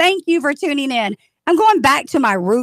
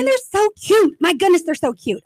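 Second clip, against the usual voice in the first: gushing; 6 semitones higher than usual. Real, not acted. Host gushing over cheerleaders.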